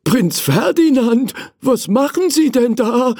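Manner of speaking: high-pitched, alarmed manservant; startled old servant. Acted. Servant finding prince in toy chest, startled. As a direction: exclaim in shock, voice shooting up. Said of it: "fragte er erschrocken".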